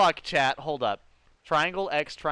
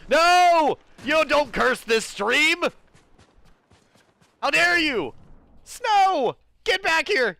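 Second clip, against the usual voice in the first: mock outrage; 13 semitones higher, 7 dB louder, much slower than usual. Scolding friend for cursing stream.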